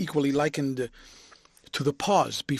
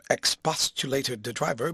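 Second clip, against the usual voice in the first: ordinary delivery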